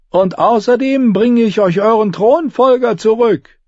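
ship captain hollering high to the quay; captain calling out. A narrator's reading. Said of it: "Sie schrien dem Kapitän ihre Fragen zu".

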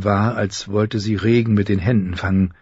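usual speaking voice

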